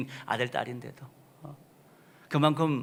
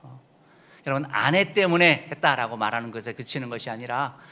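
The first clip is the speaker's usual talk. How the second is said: ordinary delivery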